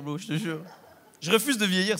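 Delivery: ordinary delivery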